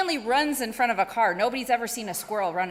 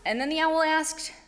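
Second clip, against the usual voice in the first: plain reading voice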